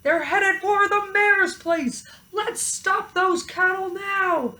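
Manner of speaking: high, loud, slow alarm; fire chief yelling. A narrator's reading. Fire chief yelling to his men, high and loud. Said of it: "The chief yelled".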